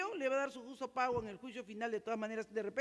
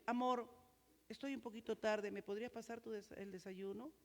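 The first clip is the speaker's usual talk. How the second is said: softer, tender asking of a spouse; gentle request; 2 semitones lower, 7 dB quieter than usual. Shown as a demonstration. Husband asking his wife kindly for breakfast, soft and tender. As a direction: ask sweetly, almost murmured. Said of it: "¿No suena mejor?".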